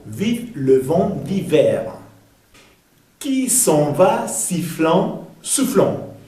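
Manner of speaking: "Vive le vent d'hiver" slow, word by word; deliberate French model, pausing between words like "sifflant" and "soufflant"; pronunciation demo. Shown as a demonstration. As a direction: space out "Qui s'en va sifflant, soufflant" for learners. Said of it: "vamos a ver cómo se pronuncia".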